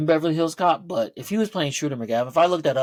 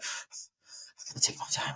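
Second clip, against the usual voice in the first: slow, breathy whisper, much softer, trying to calm down; nervous breathing; 12 dB quieter, slower than usual. Retold or imitated. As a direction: whisper it slowly while catching your breath. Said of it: "He's, like, trying to breathe".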